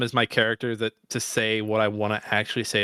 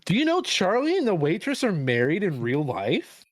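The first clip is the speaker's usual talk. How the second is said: high eager know-it-all voice; higher eager fun-fact voice; mock trivia fan; 7 semitones higher than usual. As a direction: mimic an annoying fun-fact guy. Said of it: "fun fact that everyone just pulls out".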